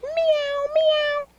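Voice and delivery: very high kitten mews; high-pitched pretend cat voice; kitty meow demonstration. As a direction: show a child how a cat meows, way up high. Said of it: "You say".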